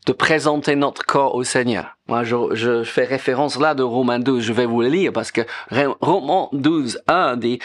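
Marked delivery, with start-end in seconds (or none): none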